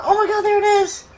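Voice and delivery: high, loud, fast thrilled yell; excited shout. Real, not acted. Gamer cheering the baby dino hatching, a loud high shout. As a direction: burst out as the egg hatches.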